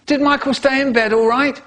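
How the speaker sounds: dad calling cheerily. Acted. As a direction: call through the door like a cheerful dad. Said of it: "My dad calls out".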